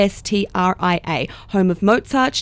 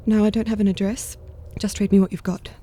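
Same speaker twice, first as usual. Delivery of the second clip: normal talking voice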